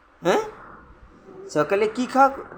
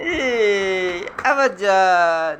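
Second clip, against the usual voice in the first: drawn out; much slower than usual. Real, not acted. Parent coaxing toddler to walk.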